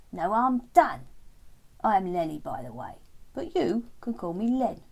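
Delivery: loud frog voice, friendly. Acted. Lenny introducing himself to Terence, loud friendly frog voice. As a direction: introduce yourself as a cheeky frog.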